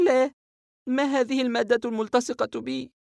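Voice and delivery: put-on a shaken girl blurting it out, high-pitched; frightened young girl. Sara voiced by narrator. (صوت فتاة خائفة بنبرة عالية)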